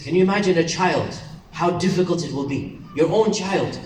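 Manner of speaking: usual speaking voice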